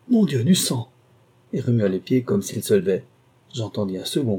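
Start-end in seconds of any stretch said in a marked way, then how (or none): 0.1-0.9 higher, louder horrified cry; old man crying out. Acted. Wounded old lord crying out at his own blood, horrified cry. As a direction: gasp it out at the sight of his blood. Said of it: "Le vieux cria".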